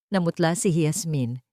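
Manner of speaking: plain reading voice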